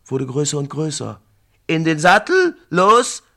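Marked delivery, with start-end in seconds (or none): 1.7-3.2 loud barked command to mount; shout. Acted. Brafane shouts at his warriors to mount up. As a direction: yell the order across the camp. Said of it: "schrie Brafane".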